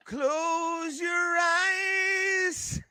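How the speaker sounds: very slow, high singsong stretch; drawn-out playful command. Pretend. Telling a friend not to read the screen, drawn-out singsong. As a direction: stretch every vowel up high.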